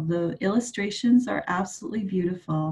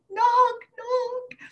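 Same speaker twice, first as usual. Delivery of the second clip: high, mock-tearful lament; hammed-up grief for a lost grandson; grandma crying out in sorrow; wailing grandma; 13 semitones higher than usual. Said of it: "Grandma cried".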